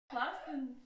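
ordinary talk, nothing marked

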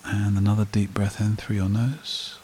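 slow, calm meditation voice with long pauses; guided meditation. Acted. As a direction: lead a breathing exercise, unhurried, leaving long silences.

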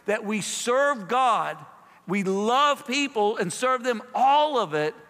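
high, loud, emphatic pulpit delivery; preaching at full force. Acted. Pastor urging his congregation, preaching high and loud. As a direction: drive it home to the congregation.

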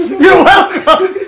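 high, loud, very slow laughing yell; mock shout. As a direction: belt it out like a cocky joke, laughing. Mock line after the throw.

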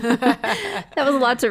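laughing while talking, slow. Real, not acted. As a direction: laugh through the words.

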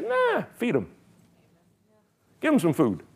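high, breezy "Nah, feed 'em"; carefree faith voice. Retold or imitated. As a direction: toss it off lightly, no fear.

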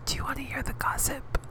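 breathy high whisper, leaning into the mic; gossip whisper. Pretend. Podcaster teasing listeners with gossip, whispered. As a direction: whisper it like a secret. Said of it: "That was some good fucking A S M R right there".

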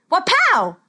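loud, high magic exclamation; triumphant spell cry. A narrator's reading. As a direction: shout the spell's final burst. Wallace finishes his spell.

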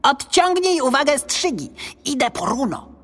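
high-pitched goblin giving orders; squeaky, resolute goblin. A narrator's reading. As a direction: instruct urgently as a little creature. Goblin gives orders during fight.

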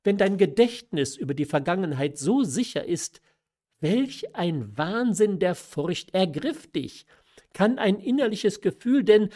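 agitated questioning. A narrator's reading. Mother challenging her daughter's fearful delusion, agitated questioning. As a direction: press the question with rising agitation.

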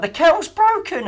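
put-on high, loud Python old-lady shriek; pepper pot voice. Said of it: "[name] sounds like a Terry Jones pepper pot".

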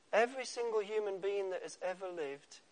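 ordinary talk

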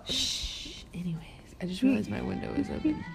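soft whispered shush; hushed aside. Real, not acted. Shushing a friend after a slip on the podcast, hushed whisper. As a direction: whisper it, moving things along.

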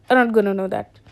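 ordinary talk

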